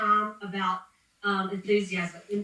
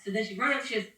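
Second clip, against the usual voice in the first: ordinary talk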